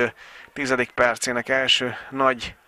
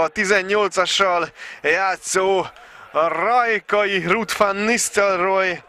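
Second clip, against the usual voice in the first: goal celebration; 8 semitones higher than usual. Real, not acted. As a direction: shout the goal with joy.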